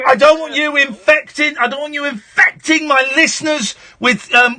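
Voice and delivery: high, loud, furious shock-jock tirade; shouted rant. Real, not acted. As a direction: bawl out the caller and dismiss him. Host rants and dismisses caller.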